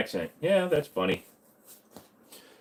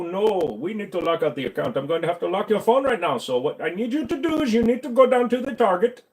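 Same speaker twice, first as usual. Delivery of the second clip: put-on higher pitched Indian accent, pushy phone scammer; Indian scam caller accent; 5 semitones higher than usual. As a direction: play an Indian accent scam caller reading his script. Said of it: "Do an Indian scam impression".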